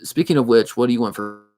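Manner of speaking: ordinary delivery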